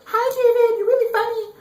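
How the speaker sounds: very high, bright mock girl voice; girly voice. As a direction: squeak it like a giddy teen girl. Girl's voice in retold chat.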